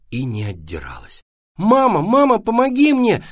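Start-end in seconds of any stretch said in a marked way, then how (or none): 1.6-3.2 put-on high, loud child's cry; urgent, high-pitched plea; shouting for help. A narrator's reading. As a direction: call out to mum in alarm. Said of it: "закричал Вася".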